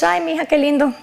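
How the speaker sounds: soft, mildly voiced mother, barely impressed; a hint of a shrugging mom; unimpressed mom. Mother shrugging off her daughter's business news, soft and unimpressed. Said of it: "She was very unimpressed".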